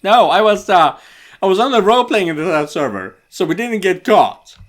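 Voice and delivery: high, loud excuse; defensive protest. Acted. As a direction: protest loudly when accused. Defends himself against accusation.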